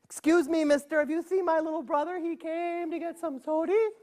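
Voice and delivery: a hint of a polite little girl. Girl asks the storekeeper politely, slightly high. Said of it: "she said".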